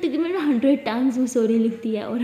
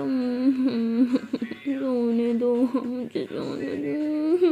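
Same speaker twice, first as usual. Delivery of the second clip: sleepy mumble; much slower than usual. Acted.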